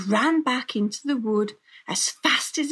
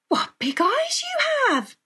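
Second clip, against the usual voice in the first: put-on very high girlish wonder; astonished storybook exclamation; amazed little girl; 4 dB louder than usual. A narrator's reading. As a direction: marvel in a high child's voice. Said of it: "she said".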